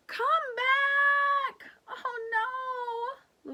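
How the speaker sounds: high loud crying call; desperate yell. Acted. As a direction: call out then break into a cry. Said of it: "Lulu cries".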